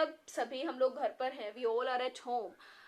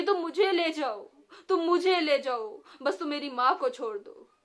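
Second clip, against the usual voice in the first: loud, higher desperate begging; crying plea; 3 semitones higher, 8 dB louder than usual. A narrator's reading. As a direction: offer himself in her place. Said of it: "रोया और बोला कि".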